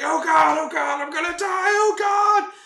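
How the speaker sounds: high, loud mock death-panic shout. Joseph stuck on the escalator, shouting in panic. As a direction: wail it out, hammed-up and high. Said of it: "he just starts shouting".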